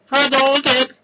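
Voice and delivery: high, loud fighting-game shout of "Hadouken!"; game attack yell. Pretend. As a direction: belt it like firing a special move. Shouts a video game attack call.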